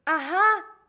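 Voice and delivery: loud, delighted shout; joyful cry. Acted. Postman recognising the chauffeur, loud joyful cry. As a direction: burst out with glee. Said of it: "vykřikl pan Kolbaba radostně".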